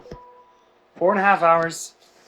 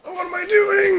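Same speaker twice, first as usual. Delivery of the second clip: anguished cry. Real, not acted. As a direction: cry it out in despair. Same words, now high outcry.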